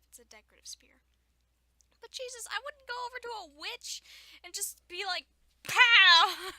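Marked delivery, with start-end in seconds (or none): 2.0-5.3 high-pitched, animated disbelief; incredulous protest. Real, not acted. Reader aghast at Max's attack on the witch, incredulous. 5.7-6.5 very loud, high "pow"; explosive mock attack. Reader acting out attacking a witch, explosive shout.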